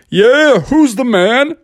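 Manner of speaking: high, cocky victory call; boastful bull. Acted. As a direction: show off to the crowd. Dancing bull boasts to crowd.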